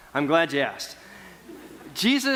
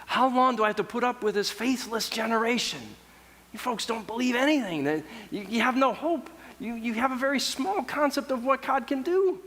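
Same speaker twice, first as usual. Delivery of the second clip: higher, fed-up rebuke of the crowd; a teacher at the end of his rope, pitched up; exasperated; 7 semitones higher than usual. Retold or imitated. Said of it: "He's, he's exasperated".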